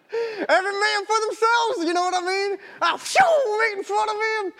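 high-pitched, excited self-justification; gleeful retelling. Retold or imitated. Runner justifying his sprint past a rival, gleeful. Excitedly reliving the water-table dash.

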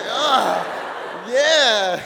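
drawn-out, loud sigh of satisfaction; relieved cry. Retold or imitated. Recalling a good cry to the audience, loudly relieved. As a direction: let it out like crying feels good. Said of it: "like, feels good".

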